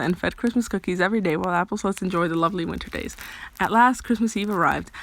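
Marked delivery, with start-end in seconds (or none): none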